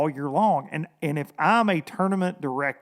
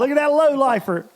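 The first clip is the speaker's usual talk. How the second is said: mock brag; 8 semitones higher, 4 dB louder than usual. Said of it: "That's what we wanna say".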